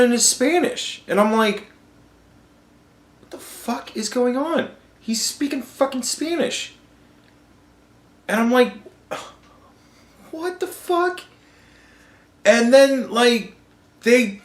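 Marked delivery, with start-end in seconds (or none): 3.2-6.7 high-pitched, hushed disbelief; baffled, voice pitched up but kept down; mock bewilderment. Viewer retelling his shock at the Spanish on the debate, bewildered. 10.3-11.2 very high, soft squeak of disbelief; mock bewilderment. Viewer reacting to candidates going into Spanish, dumbfounded. Retold baffled reaction to debate.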